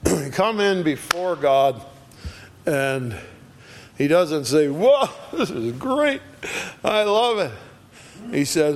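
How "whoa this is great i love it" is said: high, breezy mock cheer; mock delight. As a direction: toss it off like a shallow fan. Mock contrast to Isaiah's reaction.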